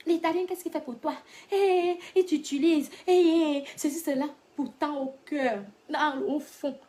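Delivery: high-pitched imitation of nagging gossip; high mock warning with drawn-out cries; mock meddling friends.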